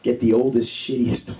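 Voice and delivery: ordinary talk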